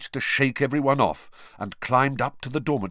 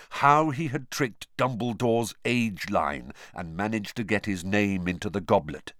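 ordinary delivery

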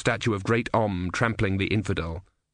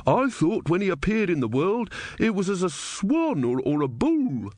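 wooden-legged man; 8 semitones higher than usual. A narrator's reading. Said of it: "said the wooden-legged man".

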